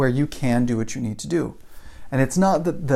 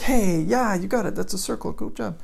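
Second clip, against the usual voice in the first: bright praise, pitched much higher; encouraging a child; 6 semitones higher than usual. Acted. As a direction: cheer the kid on like a proud parent. Praising a small child's drawing.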